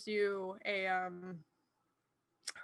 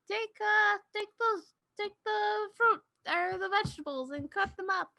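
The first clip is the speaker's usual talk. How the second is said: put-on higher old woman giving orders; high granny voice; 8 semitones higher than usual. Granny tells the party to cut vegetables, higher. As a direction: lift the pitch and instruct. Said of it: "she says".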